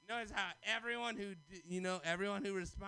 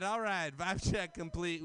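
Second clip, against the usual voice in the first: usual speaking voice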